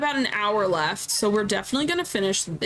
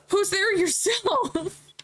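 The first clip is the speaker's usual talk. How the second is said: higher, slow teasing comeback; cocky retort; 9 semitones higher, much slower than usual. Acted. Stranger answering the challenge, higher and slow. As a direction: throw the question back slowly. Voicing the reply.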